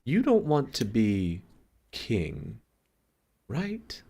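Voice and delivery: hesitant question trailing into a whisper; distracted, awkward check. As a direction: check tentatively, pausing before the last word. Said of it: "like, she's busy".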